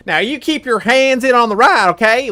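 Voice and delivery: put-on high, loud old-timey miner; hammed-up forty-niner announcer; a ride host voice telling riders to keep their hands in; gold miner voice. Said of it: "like a gold miner".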